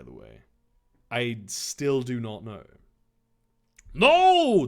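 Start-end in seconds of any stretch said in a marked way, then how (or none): 3.9-4.7 loud, high, drawn-out cry of protest; hammed-up dramatic yell. A narrator's reading. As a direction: yell it in horror. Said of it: "Dave yelled".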